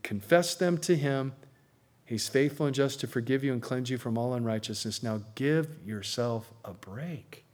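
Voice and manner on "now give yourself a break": slow, soft and lower; tender plea to let go of guilt; gentle. Real, not acted. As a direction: ease the guilt off them gently. Repeated tender exhortation.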